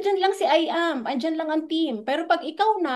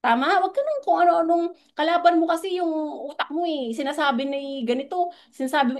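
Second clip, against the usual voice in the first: ordinary delivery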